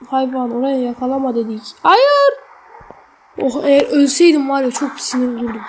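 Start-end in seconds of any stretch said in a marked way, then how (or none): 1.8-2.3 dismayed cry. Real, not acted. Character falls again.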